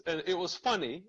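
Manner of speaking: ordinary talk, nothing marked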